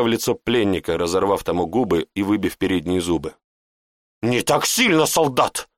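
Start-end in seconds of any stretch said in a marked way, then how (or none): 4.2-5.7 sharp angry rebuke; flaring up. A narrator's reading. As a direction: snap at the soldier. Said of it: "вспылил Вернар".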